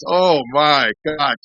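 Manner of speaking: slow, high, stretched exclamation; mock shock. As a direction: draw it out in playful astonishment. Sponsor retold as stunned.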